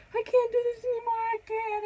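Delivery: very high, loud mock wail; retold desperate plea. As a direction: re-enact giving up in labor, high and wailing. Retold despair during labor.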